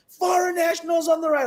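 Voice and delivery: very high mock shout of an order; US border officer. US officer answering a puzzled traveller, high impatient mock shout. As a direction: repeat the command at a confused traveller, insistently.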